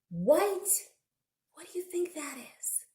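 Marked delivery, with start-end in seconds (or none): 1.5-2.8 fast, hushed teasing question; whispered question. Real, not acted. Quizzing children about the food, whispered. Asks kids.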